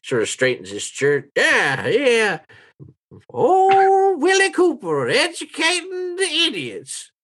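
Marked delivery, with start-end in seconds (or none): 1.4-7.1 put-on very high, crowing old man; old townsman, self-important. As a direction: crow proudly in a high old-timer voice. Old man proudly calls himself educator.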